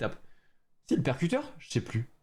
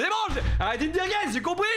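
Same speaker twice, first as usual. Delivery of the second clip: exasperated; 12 semitones higher than usual. Real, not acted. Exasperated at chat spam.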